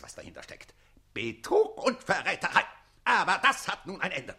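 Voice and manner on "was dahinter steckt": soft, much lower knowing whisper; menacing aside; dark whisper. Acted. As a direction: drop to a conspiratorial hush. Count hints darkly.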